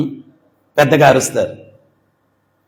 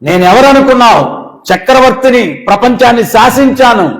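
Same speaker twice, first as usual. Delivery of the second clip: boastful emperor; 5 semitones higher, 4 dB louder than usual. Acted.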